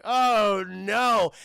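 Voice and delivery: loud, drawn-out cry of dismay; mock dismay. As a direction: wail it out in mock despair. Kid sees ruined glasses.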